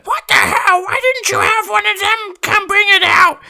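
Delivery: high, loud imitation of grandma; hammed-up indignant yelling, very high; mock outraged scolding. Grandma scolds over the dropped turkey, mock outrage.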